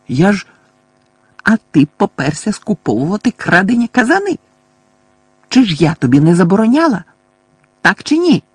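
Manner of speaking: put-on old neighbour scolding a shirker; angry scolding. A narrator's reading. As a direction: demand an answer, peaking on так чи ні. Fig scolds Mundungus.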